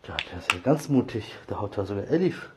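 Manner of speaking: ordinary talk, nothing marked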